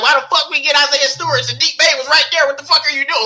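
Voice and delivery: high, loud mock outrage; pissed-off rant. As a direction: yell at the front office in disbelief. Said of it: "we were pissed".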